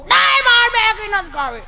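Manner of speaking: high, slow, loud whine; whiny spoiled child. Acted. Spoiled child refusing mother's Maggi, whining high. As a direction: drag out the refusal like a pampered kid.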